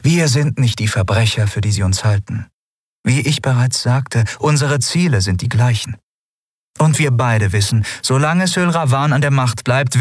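calm, polite ruler holding back a secret; lightly voiced empress. A narrator's reading.

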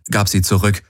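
ordinary delivery, nothing marked

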